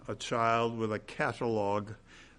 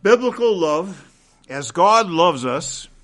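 louder, higher, proclaimed; emphatic proclamation; 5 semitones higher, 11 dB louder than usual. Real, not acted. Preacher proclaiming the point to the congregation, louder. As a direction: ring out Biblical love and God loudly.

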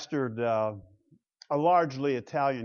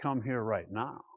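soft inviting to wife; 5 semitones lower, 8 dB quieter than usual. Shown as a demonstration. Husband calling his wife, soft and inviting. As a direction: lower and softer, inviting. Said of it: "I can tell my wife".

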